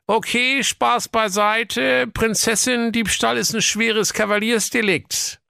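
high, officious cop voice; police commissioner voice. Acted. (hohe, wichtigtuerische Kommissarstimme)

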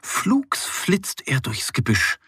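ordinary delivery

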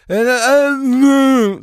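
loud, high, mock inarticulate groan; confused hotline caller. Caller tricking the computer menu into failing, loud mock gibberish. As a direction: blurt out hammed-up nonsense to baffle the machine. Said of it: "Wenn du nichts sagst oder wenn du".

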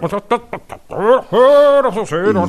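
high, fast [name] mumble; lazy rock-star drawl, sped up; Ingo Appelt as [name]. Retold or imitated. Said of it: "war Ingo Appelt, wie er [name] parodiert".

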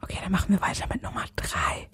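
whispered joke announcement; playful whisper. Pretend. Host announcing next clip, playful whisper. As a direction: keep whispering as a gag. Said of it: "wenn du flüsterst".

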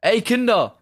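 mock holler to the kids; imitated neighbor's shout. Neighbor stepping out the door to his children, imitated shout. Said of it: "der schreit so".

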